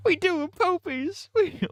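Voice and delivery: squeaky high, slow gangster line. Retold or imitated. Streamer repeats the gangbanger bit, slow squeaky high voice. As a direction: draw it out in a tiny tough-guy squeak.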